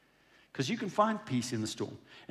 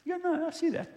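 breezy unbothered. Retold or imitated. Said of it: "The world should look at the church and go".

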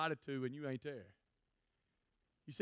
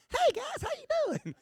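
much higher, chirpy God greeting; absurdly high-pitched voice of God; surprising high God voice; 4 dB louder than usual. Retold or imitated. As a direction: squeak the greeting like a tiny cheerful God. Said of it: "God's voice is gonna be like".

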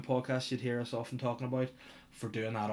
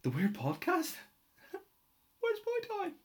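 puzzled listener. Pretend. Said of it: "people are listening going".

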